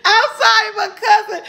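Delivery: high, loud laughing apology. Real, not acted. Apologizing to her cousin, laughing. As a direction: apologize while crying laughing.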